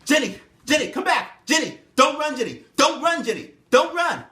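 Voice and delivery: loud, high shouts after her; calling out. Acted. Master shouting after Jenny running across the fields. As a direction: yell after a running girl.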